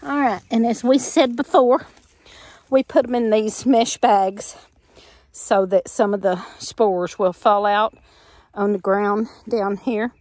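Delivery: normal talking voice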